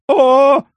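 high loud anguished howl; howl of pain. A narrator's reading. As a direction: wail as if a tooth is pulled. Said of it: "завопил Ляховский, точно у него вырывали зуб".

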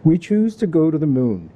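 put-on a lightly done New England accent, JFK style; mild New England accent doing JFK. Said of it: "but I'll do my best".

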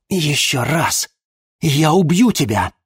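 slow, loud, high fury; furious threat. Acted. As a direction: threaten to kill him. Chloe slams hero into tree.

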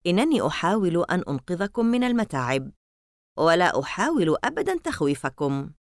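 usual speaking voice

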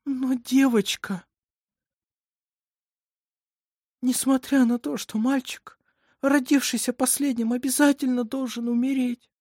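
high, plaintive voice; fearful mother. A narrator's reading. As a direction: voice grim superstition with helpless dread. Mother fears for the children.

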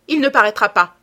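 loud, forceful outburst; commanding shout; exclaiming. A narrator's reading. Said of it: "s'écria-t-il".

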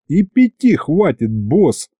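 ordinary delivery, nothing marked

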